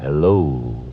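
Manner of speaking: very low, velvety hello; plushiest voice. Acted. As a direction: pour on the plush, dropping deep. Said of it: "I said hello in my plushiest voice".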